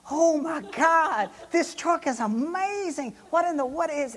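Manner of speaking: high, stretched gushing wonder; amazed outburst. Retold or imitated. Passenger reacting to the jumping truck, high amazed outburst. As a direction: exclaim it way up high, overwhelmed.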